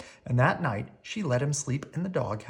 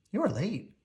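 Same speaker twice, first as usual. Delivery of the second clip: gentle scolding; 6 semitones higher than usual. A narrator's reading. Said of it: "scolded gently".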